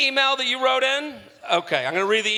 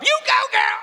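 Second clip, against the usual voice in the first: cheering. Real, not acted. Cheering the guest on.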